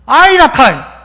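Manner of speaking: loud falling Gyeongsang 아이라카이; Gyeongsang dialect. Shown as a demonstration. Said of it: "이걸 경상도 말로 하면".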